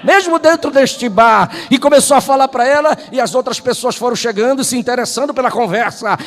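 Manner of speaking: a fervent altar call; preaching at full force. Acted. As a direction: preach it and push toward the climax. Preaching climax.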